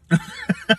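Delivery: a sudden high burst of laughter. Real, not acted. Preacher laughing at how fired up he is, high laugh. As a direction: laugh at yourself, caught up in it.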